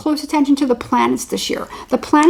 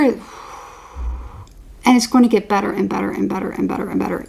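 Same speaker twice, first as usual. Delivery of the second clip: ordinary talk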